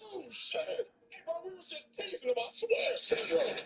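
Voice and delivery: high, fast, mock crying plea; panicked begging. Scared thugs beg Treble for mercy, high whiny crying.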